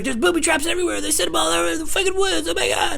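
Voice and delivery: high, loud panicked put-on voice; imitated paranoid neighbor. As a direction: play the neighbor raving about traps. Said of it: "He's like".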